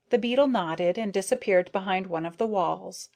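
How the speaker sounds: ordinary delivery, nothing marked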